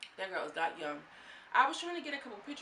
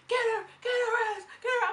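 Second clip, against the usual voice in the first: high, loud egging-on yell; loud, high cheering from the sidelines; cheering shout; 8 dB louder than usual. Retold or imitated. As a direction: urge her into the fight. Voicing Sapphire cheering the fight.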